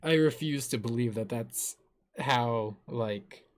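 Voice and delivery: ordinary talk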